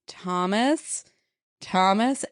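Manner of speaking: retold name-call with rising tune. Calling Thomas out of a trance, retold call-out. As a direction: imitate calling to someone in a trance. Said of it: "She says it three times".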